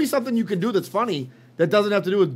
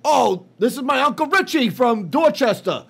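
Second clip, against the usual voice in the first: higher, mocking Boston accent; hack Boston accent; 6 semitones higher, 3 dB louder than usual. Mocking the skit makers' shtick, hammed-up Boston accent. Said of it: "So their whole shtick is".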